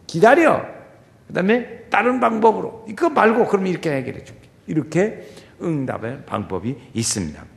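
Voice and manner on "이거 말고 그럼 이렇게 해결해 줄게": ordinary talk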